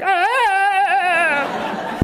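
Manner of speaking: long high creature wail; drawn-out, high imitation of a Wookiee cry; Chewbacca roar. Speaker showing the noise their soul made, mock creature wail. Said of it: "my soul made an actual Chewbacca".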